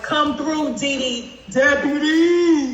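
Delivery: laughing hype shout, drawn out; slow, laughing hype call; mock hype call. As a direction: call her up like a crowd cheering. Hyping up a colleague, laughing.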